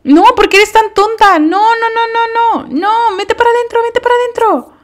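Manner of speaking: very high, loud frantic scolding; exasperated yelling. Real, not acted. Player yells at her sim to get back indoors. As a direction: shout the orders in a panic.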